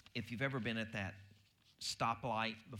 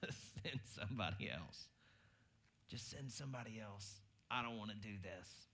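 whiny reluctant plea; 2 semitones lower, 5 dB quieter than usual. Retold or imitated. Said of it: "the classic response that we all do".

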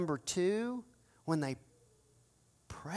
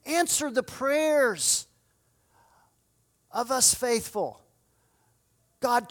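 higher, pleading prayer, slow on transform; fervent cried-out prayer; 5 semitones higher, 3 dB louder than usual. Acted. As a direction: cry out to God for the city, drawn out. Voiced fervent prayer for the city.

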